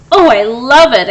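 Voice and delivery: loud, delighted exclamation; exclaimed. A narrator's reading. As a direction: gush over the new tooth. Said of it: "exclaimed the fox".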